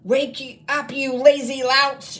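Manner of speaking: high, loud shriek; a witch headmistress shrieking at her lazy students. Acted. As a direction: shriek at the sleeping goblins. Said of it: "she shrieked".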